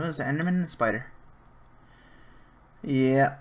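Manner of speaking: ordinary talk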